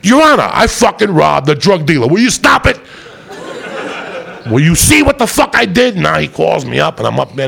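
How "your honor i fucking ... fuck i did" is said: mock outraged shout. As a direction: yell it at the judge in disbelief. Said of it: "That's why I was like".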